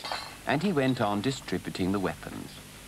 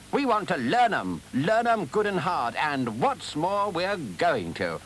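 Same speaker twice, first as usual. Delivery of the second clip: raised pitch, slowing at the end; emphatic insistence; 7 semitones higher, slower than usual. Acted. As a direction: insist firmly, then draw out the last words. Badger defiantly insists on learn 'em.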